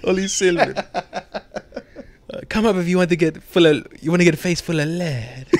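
high goofy voice through laughs; high, laughing cartoon soldier; laughing mock soldier. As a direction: play the guard while cracking up.

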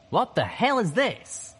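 high, loud, slow outraged question; heated demand. A narrator's reading. Calamity confronts his double in a heated argument, loud outrage. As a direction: demand an answer mid-argument, drawing it out.

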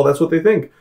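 ordinary delivery

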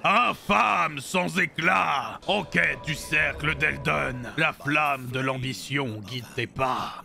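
very slow, low, portentous delivery; solemn foe. Acted. Boss addressing the tarnished, solemn and slow. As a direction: intone each phrase slowly and gravely. Voices solemn game line.

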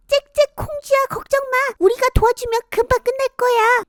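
very high chirping bird voice; chirpy sparrows. Acted. Sparrows cheering up Kongjwi, very high chirpy voice.

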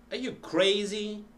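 CRAZY lifted high as a question; question intonation. Shown as a demonstration. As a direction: ask it with the pitch rising on "crazy". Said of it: "intonation many times is linked to the function".

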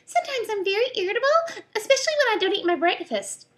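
very high, dainty voice; reply daintily, nothing like a pirate; prim lady rascal. Acted. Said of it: "replied the rascal".

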